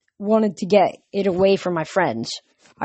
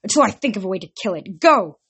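urgent. Acted. Zoe commanding in battle.

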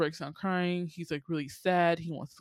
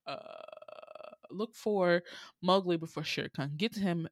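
normal talking voice

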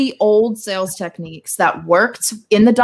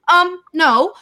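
sarcastic retort; 8 semitones higher than usual. Acted. Mocking advice to ignore no.

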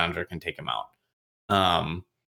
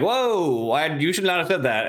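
alarmed gasp; 6 semitones higher than usual. Retold or imitated. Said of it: "Shadid is like".

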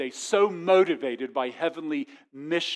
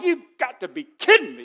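louder, higher, fed-up retort; exasperated disbelief; 7 semitones higher, 8 dB louder than usual. Retold or imitated. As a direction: throw it out like a put-upon worker. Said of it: "I'm like".

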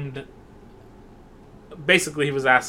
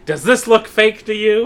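loud, high, menacing retort; angry; 8 semitones higher, 7 dB louder than usual. Retold or imitated. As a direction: throw the challenge at her. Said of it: "he pulls out a gun and he's like".

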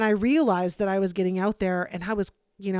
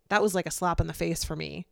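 ordinary delivery

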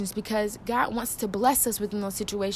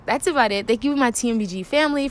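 normal talking voice

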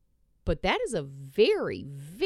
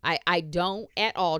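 ordinary delivery

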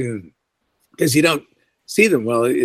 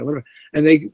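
normal talking voice

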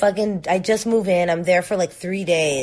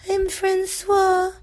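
creepy doll voice; 14 semitones higher than usual. Pretend. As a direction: speak as a too calm creepy doll. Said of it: "Is that what his voice sounds like?".

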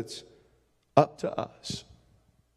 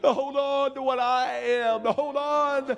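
pleading prayer rising higher over music; fervent prayer; 6 semitones higher, slower than usual. Acted. Preacher praying before the congregation at the altar, fervent and pleading. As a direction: cry out to the Lord, longing and earnest.

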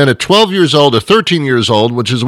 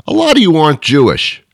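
ordinary talk, nothing marked

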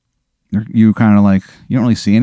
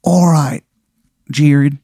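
put-on lighter touch of the awful Maryland accent, a bit higher; bad Maryland accent; 4 semitones higher, slower than usual. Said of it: "the worst Maryland accent you have ever heard".

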